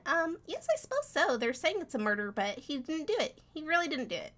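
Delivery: high, protesting little mouse; small high voice insisting; high-pitched mouse. Acted. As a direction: pitch up, pleading a friend's innocence. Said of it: "high-pitch my voice".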